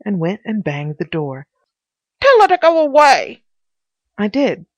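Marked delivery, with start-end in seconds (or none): 2.2-3.4 high, loud shout from Pycraft; piping bawl. A narrator's reading. Pycraft behind the door wanting her gone, piping bawl. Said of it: "a piping bawl from inside the door".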